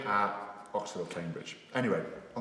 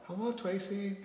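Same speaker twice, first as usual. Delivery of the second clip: much higher mock accent voice; put-on Japanese voice; 11 semitones higher, 4 dB quieter, slower than usual. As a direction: play the Japanese player greeting her. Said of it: "And I'm like".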